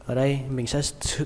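ordinary talk, nothing marked

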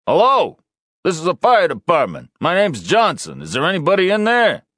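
high, loud call through a door; shouted call. Acted. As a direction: shout in to anyone trapped. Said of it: "The officer beside them shouted out".

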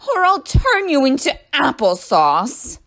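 high, loud, drawn-out menace; shouted threat. A narrator's reading. Teacher to rebelling apples, shouted threat. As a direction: threaten slowly at full voice. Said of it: "she shouted".

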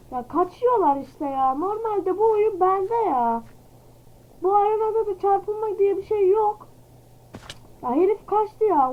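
real frustrated whine, voice close to tears; whiny near-tearful complaint. Real, not acted. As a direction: moan it like you're about to cry over losing. Game opponent keeps escaping.

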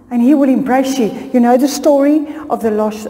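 normal talking voice

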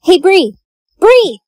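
sharp call across the room. Acted. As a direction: shout her name to wake her up. Calling out to wake her.

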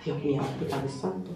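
murmura muy bajo y grave; murmullo. Real, not acted. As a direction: lo dice para sí, casi sin voz. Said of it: "susurro de asombro". (murmullo muy bajo y grave)

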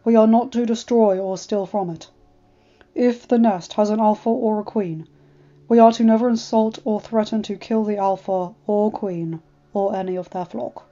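lightly voiced dragon, grave and formal; a hint of a humble old dragon awaiting judgment; solemn dragon voice. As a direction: Nightmare dragon bowing before the alpha, lightly voiced and grave. Nightmare explains civility laws.

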